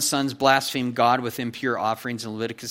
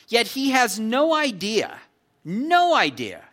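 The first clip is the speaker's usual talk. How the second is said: NO raised and slowed, idea said after it; slow build on "no idea", then louder; stress on NO; 7 semitones higher, 4 dB louder, slower than usual. Real, not acted. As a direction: lean hard on no in no idea. Preacher emphasizing repeated phrase.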